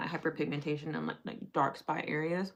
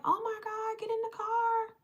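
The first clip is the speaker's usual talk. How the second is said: very high-pitched imitation of a concerned stranger; urgent retold plea; 3 dB louder than usual. Driver rescues them from hail, urgent high-pitched imitation. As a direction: imitate her urging, pitched way up.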